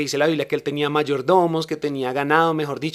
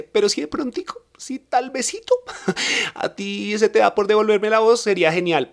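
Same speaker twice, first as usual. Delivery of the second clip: cheeky plea; 6 semitones higher than usual. Retold or imitated. Cheekily bargaining with God.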